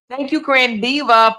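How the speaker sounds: normal talking voice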